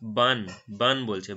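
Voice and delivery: "বান" said slowly with an open ah, like Bengali bun for bread; British middle syllable, slow and open; British "bahn". Shown as a demonstration. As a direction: draw out "বান" with a broad a. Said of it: "একটা হচ্ছে ব্যান আর একটা হচ্ছে বান".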